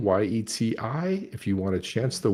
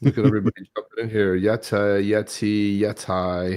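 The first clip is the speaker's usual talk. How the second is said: usual speaking voice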